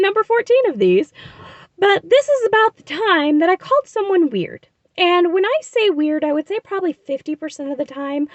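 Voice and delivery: high, chirpy vlogger voice; upbeat on-camera presenter lilt; bright vlog intro. Acted. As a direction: sell the episode like a host. Upbeat vlog intro and topic read.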